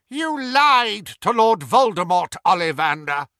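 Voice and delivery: high, loud, furious voice; Voldemort rage. Acted. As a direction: spit it out in cold fury. Voldemort raging at prisoner.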